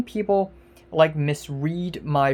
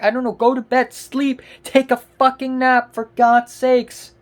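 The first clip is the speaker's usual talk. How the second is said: loud, high exasperated yelling; real irritated outburst; angry shouting; 5 semitones higher, 5 dB louder than usual. Real, not acted. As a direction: tell the internet to chill, furious. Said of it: "maybe I'm angry right now".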